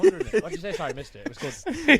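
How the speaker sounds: high, loud laughing talk; laughing through the words. Real, not acted. Laughing at a joke mid-sentence.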